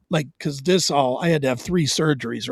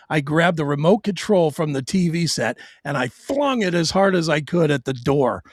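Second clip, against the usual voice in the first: usual speaking voice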